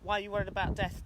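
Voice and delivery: ordinary delivery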